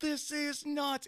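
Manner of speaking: high, excited telling; bursting to tell the news. Retold or imitated. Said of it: "I was like".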